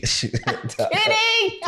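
high laughing "Kidding". Real, not acted. Retracting a joke about kids, laughing. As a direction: take it back with a laugh. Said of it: "I'm kidding, kids".

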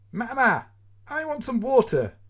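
put-on high, loud child's call; a little boy calling for his mother; child crying out. A narrator's reading. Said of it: "he hears his son cry".

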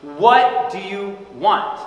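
loud, high, slow demand; emphatic word-by-word question; insistent demand. Acted. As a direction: drive each word home slowly. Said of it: "Jesus has to do that".

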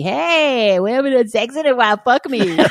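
high, fast, teasing come-on; mock needy demand. Mock needy come-on to husband. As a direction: ham up a demanding wife begging husband.

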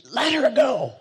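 a sharp, high, loud retold shout; sudden shout. Zen master to heartbroken student, sudden loud shout. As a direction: snap him out of it with a yell. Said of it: "and he shouted".

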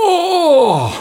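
awed gasp. Acted. Kids see the giant waffle.

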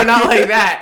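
high, loud embarrassed denial; flustered protest. Retold or imitated. Acting the hero's embarrassed denial.

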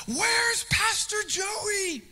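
high, drawn-out yell for the pastor; imitated scream. Stalker woman yelling for Pastor Joey outside offices, high slow scream. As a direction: shriek the question up high and slow. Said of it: "she would just scream this".

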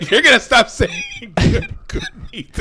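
laughing through the words; giggling protest. Real, not acted.

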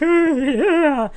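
high-pitched mock sobbing; hammed-up tearful kid voice; bawling. Crying boy agreeing to call his mom, mock bawling. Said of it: "bawling and in tears".

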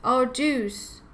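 understated crying mother; mock boo-hoo. A narrator's reading. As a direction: lament it like a lightly acted boo-hoo. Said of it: "boo-hooed Ava's mother".

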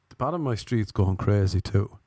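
normal talking voice

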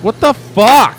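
high, loud outburst of disgust; disgusted shout. Real, not acted. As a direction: shriek it in real revulsion. Disgusted by gross video.

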